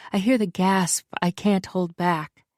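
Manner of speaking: ordinary delivery, nothing marked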